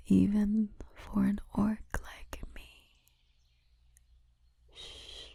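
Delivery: usual speaking voice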